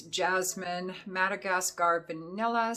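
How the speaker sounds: ordinary talk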